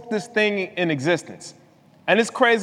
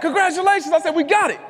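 high-pitched victory cry, re-enacted; retold excitement; 9 semitones higher than usual. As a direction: celebrate as if the deal is yours. Retelling his mistaken celebration.

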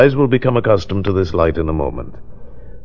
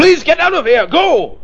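high-pitched frantic dismissal; desperate shouting; 4 dB louder than usual. Acted. Psychic doctor begging client to leave, desperate high shout. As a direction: cry out to make them leave at once.

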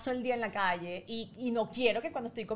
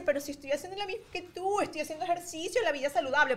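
put-on higher, childish protest in a daughter's voice; sassy little-girl reply, raised pitch; imitating her daughter; 6 semitones higher than usual. Mother mimics her daughter talking back, high childish voice. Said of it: "ella me dice".

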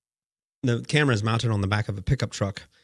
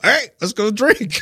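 imitating the alcoholic; 10 semitones higher, 5 dB louder, slower than usual. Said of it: "Thelma the alcoholic comes pulling up".

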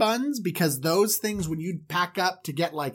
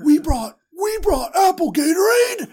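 high, loud, yelling with excitement; child in the back seat, loud and high; hyped kid; 13 semitones higher, 6 dB louder than usual. Acted. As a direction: shout it like a sugar-rushed kid. Kids hyped about snacks.

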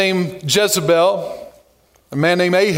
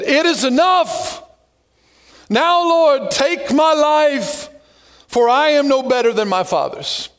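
high, slow, worn-out plea to God; despairing prayer; 5 semitones higher, slower than usual. Acted. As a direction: pray like a man at the end of his rope. Elijah's despairing prayer in scripture.

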